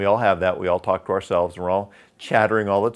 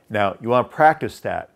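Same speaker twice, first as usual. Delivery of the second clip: ordinary talk, nothing marked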